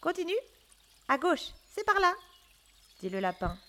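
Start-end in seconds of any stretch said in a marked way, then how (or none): 0.0-2.1 lightly voiced rabbit directions; rabbit guiding. A narrator's reading. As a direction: guide him along in a hurry. Rabbit voice in story.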